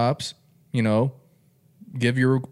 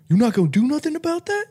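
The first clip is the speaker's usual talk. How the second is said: put-on high-pitched, pushy girlfriend voice; high mock ex's challenge; 3 dB louder, faster than usual. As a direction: voice the ex egging him on, way up high.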